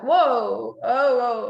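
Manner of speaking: loud, startled retold whoa. Fireball explodes in her hands, startled loud whoa. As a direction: gasp it out as the fire blows up. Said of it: "And I was like".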